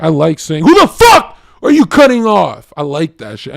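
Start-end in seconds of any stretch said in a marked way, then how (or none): 0.6-2.7 acted high, loud road-rage yell. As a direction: explode at a driver who cut you off. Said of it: "when someone cuts me off in traffic".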